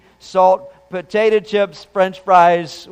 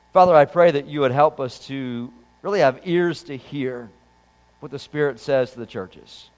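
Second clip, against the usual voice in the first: prayer; 4 semitones lower than usual. Acted. Pastor opens the sermon with prayer.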